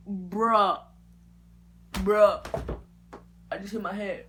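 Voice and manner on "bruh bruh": loud, stunned groan of bruh; dismayed bruh. Retold or imitated. Staring at broken hair, loud dismayed bruh. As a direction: moan it out in disbelief.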